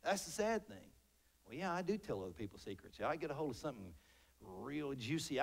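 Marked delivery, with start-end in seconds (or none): 1.4-5.4 low, very soft mock confession; confiding gossip. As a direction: play a gossip confiding under the breath.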